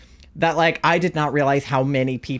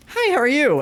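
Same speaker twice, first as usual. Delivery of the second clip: cashier greeting voice; 10 semitones higher than usual. Retold or imitated. Cheerful cashier greeting to customer.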